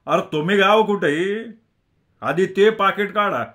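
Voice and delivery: loud, high, insistent order; angry demand. Acted. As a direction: demand it back forcefully. Mob leader demands the wallet.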